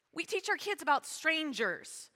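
ordinary talk

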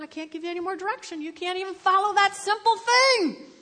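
high, quick, building to loud; exasperated scolding. Acted. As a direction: scold with mounting mock exasperation.

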